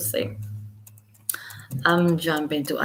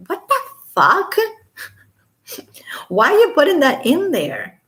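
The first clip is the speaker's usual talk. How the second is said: much higher, incredulous outburst; disbelief; 10 semitones higher, 4 dB louder than usual. Real, not acted. Reacting to the filing naming Charles Manson, incredulous. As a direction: exclaim in stunned disbelief.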